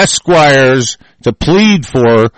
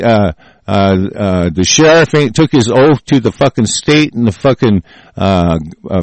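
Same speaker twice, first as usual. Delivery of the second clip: ordinary talk, nothing marked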